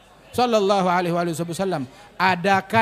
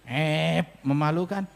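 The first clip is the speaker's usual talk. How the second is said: normal talking voice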